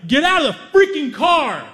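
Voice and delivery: loud, high, angry roar at the car; imitating a furious shouting guy; furious yell. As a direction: bellow it like an enraged stranger. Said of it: "he's pissed".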